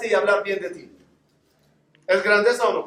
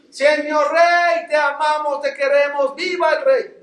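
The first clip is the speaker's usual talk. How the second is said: loud, high, slow cheer from a balcony; shouting tailor; 8 semitones higher, 5 dB louder, slower than usual. Retold or imitated. Tailor on the balcony calling down to the king, loud, high and slow. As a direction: call out to the king, drawn out and high.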